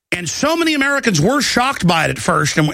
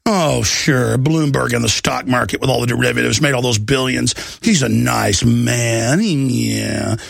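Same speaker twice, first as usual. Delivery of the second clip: low, slow mock agreement; sarcastic praise; 8 semitones lower than usual. Host pretending Bloomberg and friends are nice, low sarcastic drawl. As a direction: drawl it with heavy irony.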